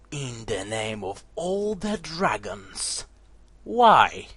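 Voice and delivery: low, slow pleading question, lifting on why; a hint of a stern commander; lightly voiced commander. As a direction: ask in grave disbelief. Kiran asks Akane.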